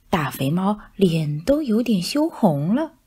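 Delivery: ordinary delivery, nothing marked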